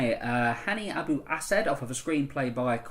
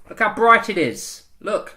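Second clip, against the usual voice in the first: louder, higher pointed remark meant to be overheard; pointed passive-aggressive remark; 8 semitones higher, 10 dB louder, slower than usual. Retold or imitated. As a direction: say it loudly so the woman hears. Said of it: "lit it up and just went".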